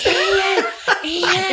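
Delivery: high loud hushed calling; whisper yelling. Retold or imitated. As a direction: yell it in a stage whisper. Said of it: "this kind of is what Claire going".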